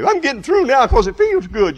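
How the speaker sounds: high mock voice of a pleased praying believer; preacher imitating a self-satisfied believer; mock smug believer. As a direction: play someone pleased with their prayers. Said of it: "you say".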